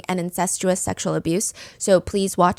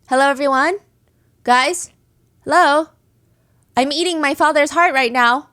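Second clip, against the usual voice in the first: raised voice addressing a crowd; public announcement; 5 semitones higher, 4 dB louder than usual. Acted. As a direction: call out higher, in separate bursts, as if for attention.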